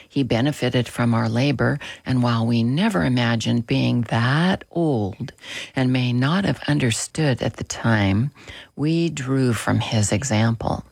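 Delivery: ordinary talk, nothing marked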